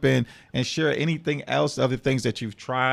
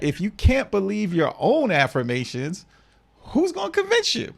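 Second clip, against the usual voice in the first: higher, emphatic rhetorical challenge; hyped-up repeat; 7 semitones higher than usual. Retold or imitated. Host repeating Maria's chat line to the panel, hyped. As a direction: drive the question home, pitch leaping at the end. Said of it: "Let me drop a cruise bomb on that".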